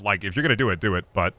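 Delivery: ordinary talk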